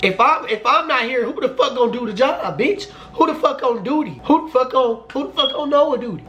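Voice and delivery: laughing, high-pitched imaginary tell-off; indignant rant. Pretend. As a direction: tell her off in your head, climbing high. Ranting at boyfriend's absent mom.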